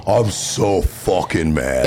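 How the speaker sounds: very slow, low mock seething; retold seething anger. Passenger stewing behind lost driver, slow retold seething. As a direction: drag out every word, low and fuming. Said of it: "I was literally going".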